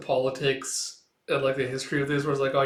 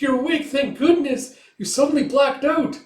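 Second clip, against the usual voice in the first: high, louder relieved outburst; relieved cry; 12 semitones higher, 7 dB louder than usual. Retold or imitated. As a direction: gush with relief. Relieved girl as he wakes.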